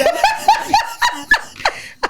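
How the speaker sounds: high, breathless laughing fit; helpless laughter. Real, not acted. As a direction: lose it completely, squealing with laughter. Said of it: "[name], you okay?".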